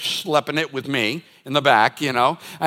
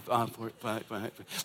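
muttered counting; 7 semitones lower, 15 dB quieter than usual. Retold or imitated. Counting seat rows on plane.

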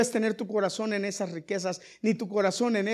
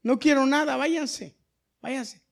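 Balaam refusing; 7 semitones higher, 8 dB louder than usual. Pretend. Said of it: "cuál debió ser la postura de Balaam".

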